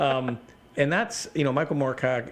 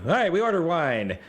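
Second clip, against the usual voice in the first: eager player; 8 semitones higher, 4 dB louder than usual. Retold or imitated. Player answering the game master.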